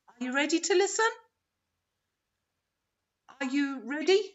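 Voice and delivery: ordinary talk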